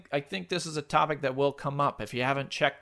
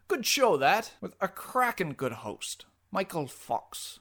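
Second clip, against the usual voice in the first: hammed-up posh English accent, starting higher; a jolly English radio host, faked; fake English accent; 4 semitones higher than usual. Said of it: "Nice work with your fake English accent".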